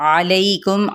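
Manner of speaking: usual speaking voice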